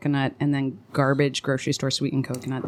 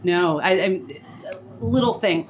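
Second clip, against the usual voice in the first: normal talking voice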